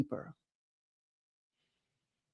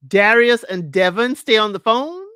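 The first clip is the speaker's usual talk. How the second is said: loud, emphatic rising question; punched words climbing into a question; incredulous question; 2 semitones higher, 6 dB louder than usual. Real, not acted. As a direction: repeat it in disbelief, swinging up at the end.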